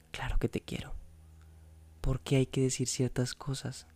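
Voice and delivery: hushed, tender whisper; soft whisper. A narrator's reading. María reassuring Juan Pablo in the dark, whispering. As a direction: breathe it gently in the dark. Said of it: "Me dijo suavemente".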